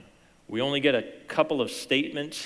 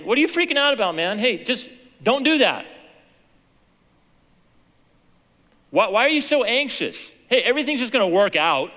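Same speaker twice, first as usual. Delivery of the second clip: loud, much higher, exasperated scolding; mock scolding rant; 12 semitones higher, 11 dB louder than usual. Preacher mocking how Jesus did not scold, loud rant. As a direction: shout it like an impatient friend brushing off worry. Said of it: "Jesus doesn't say".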